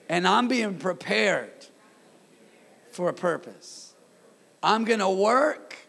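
rousing, deliberate pulpit call; call-and-repeat declaration. Real, not acted. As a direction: proclaim it slowly for the congregation to echo. Leads congregation in repeated declaration.

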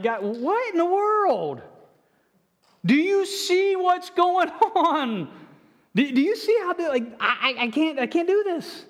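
high, slow and pleading, voice straining upward; venting frustration at God with a half laugh; exasperated crying out to God. Retold or imitated. Preacher acting out his desperate prayer to God, high and pleading. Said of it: "usually it's a little bit more colorful".

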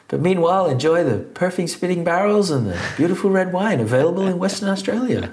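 high, loud cabin announcement voice; flight attendant voice. Retold or imitated. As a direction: sell the wine like a cabin crew announcement. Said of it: "Joe in his flight attendant voice".

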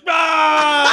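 loud shouted heave; mock effort roar. Packy roaring as he shoves the guy out.

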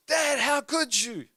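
put-on high, drawn-out child's reproach; outraged daughter imitation. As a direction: act out a scandalized kid, pitched high. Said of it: "bursts through my bedroom".

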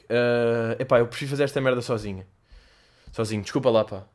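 normal talking voice

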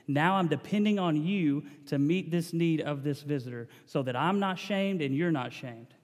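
ordinary talk

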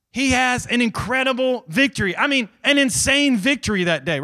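animated preacher piling up examples; excited preaching. Real, not acted. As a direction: marvel at the victory for the crowd. Preacher at full force.

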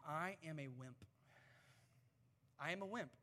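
soft, sheepish admission; hushed self-deprecating confession; quiet confession. Real, not acted. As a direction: own up quietly. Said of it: "It's a confession from me to you".

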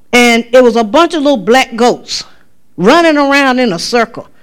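usual speaking voice

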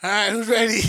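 hyped bus driver call. Retold or imitated. Quoting himself to the kids.

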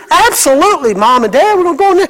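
put-on high, bouncy eager kid voice; mock-cheerful child agreeing to clean up. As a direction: chirp it like a kid happy to obey.

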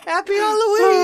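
laughing. Real, not acted. After a long laughing fit.

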